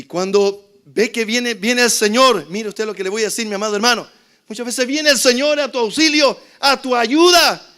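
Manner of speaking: loud, high-pitched sermon storytelling; fiery preaching. Acted. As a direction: preach it at full force to the congregation. Preaching at full force.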